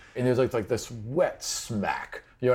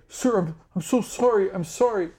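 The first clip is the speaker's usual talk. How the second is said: high, pleading apology in a much higher voice; cowering servant; 11 semitones higher, 3 dB louder than usual. Acted. Game master voicing the beaten servant, high and pleading. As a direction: beg forgiveness, voice pitched way up. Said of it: "He's like".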